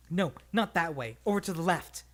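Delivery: loud call to turn left; loud urgent call; shouted. A narrator's reading. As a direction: shout the directions over the running. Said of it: "shouted Tristan".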